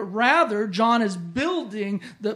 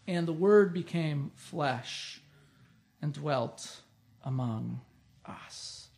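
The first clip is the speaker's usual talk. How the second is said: hushed scripture reading; 6 semitones lower, 6 dB quieter, slower than usual. Acted. Preacher reading the verse to the congregation, hushed and slow. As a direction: drop softer and slower, fading to a whisper on the last word.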